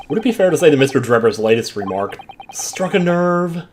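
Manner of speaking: usual speaking voice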